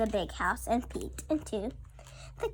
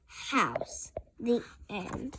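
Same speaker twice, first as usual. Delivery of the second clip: whisper; 6 dB quieter, much slower than usual. Acted. Whispered story ending.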